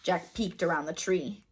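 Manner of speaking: usual speaking voice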